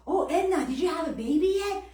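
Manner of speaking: chirpy mock busybody; nosy cashier. Cashier asking Edna about a baby again, in a high mock voice. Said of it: "she said".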